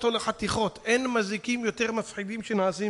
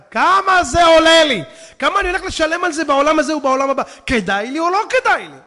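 loud, high, hammered-out questions; urgent self-questioning; 7 semitones higher, 11 dB louder than usual. Acted. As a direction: shout it like a preacher demanding a reckoning. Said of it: "שאל את עצמך". (שאלות נוקבות בצעקה)

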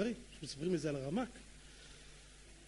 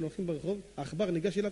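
usual speaking voice

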